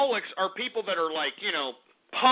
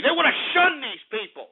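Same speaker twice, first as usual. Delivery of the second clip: ordinary talk, nothing marked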